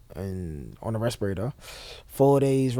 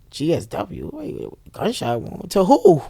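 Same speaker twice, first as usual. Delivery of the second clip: startled realization; 7 semitones higher, 6 dB louder, slower than usual. Retold or imitated. EMT realizing he is shot.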